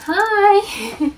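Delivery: usual speaking voice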